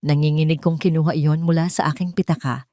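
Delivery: ordinary delivery, nothing marked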